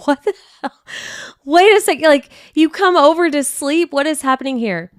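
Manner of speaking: high, loud, mock-furious rant; indignant high-pitched complaining; exasperated outburst. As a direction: let the frustration boil over. Angry retelling of frustration with mom.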